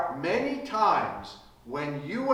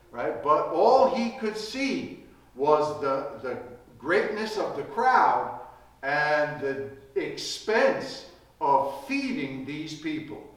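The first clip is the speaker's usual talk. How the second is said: ordinary delivery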